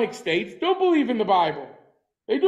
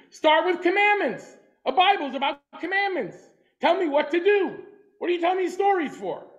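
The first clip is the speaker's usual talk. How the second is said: impatient challenge; 7 semitones higher than usual. Retold or imitated. A teacher voicing Rashi's skeptical questioner, mock impatience. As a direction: push back like a skeptic demanding rules, not stories. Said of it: "he says".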